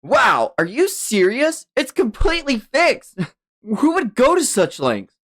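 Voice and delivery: high-pitched astonished voice; incredulous amazement. Acted. Youngster marvels at the mended vase, high and incredulous. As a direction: marvel in disbelief, pitch leaping up.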